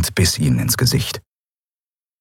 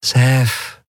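higher, syrupy coaxing; sugary sweet; 5 semitones higher than usual. A narrator's reading. Woman warning the man holding her at gunpoint, sugary sweet. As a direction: say the name with sweet menace. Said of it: "sagte sie zuckersüß".

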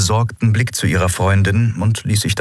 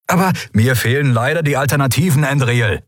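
raised-pitch irritated retort; sharp, higher-pitched outburst; barked; 8 semitones higher than usual. A narrator's reading. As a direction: bark it back, frustrated. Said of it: "bellte er".

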